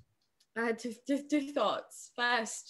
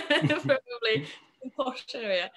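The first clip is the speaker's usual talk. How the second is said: laughing; 5 semitones higher, 8 dB louder than usual. Real, not acted. Guest joking about moving to London, laughing.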